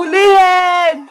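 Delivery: long, drawn-out battle cry; rallying shout. Acted. Children rushing to catch Wednesday, rallying shout. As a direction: stretch the word into a charge.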